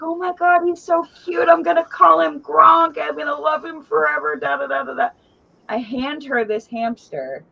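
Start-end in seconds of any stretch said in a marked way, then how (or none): 0.0-5.1 put-on high, gushing child voice, talking fast at the end; gushing little girl. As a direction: gush over the cute hamster like a delighted kid. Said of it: "she's like".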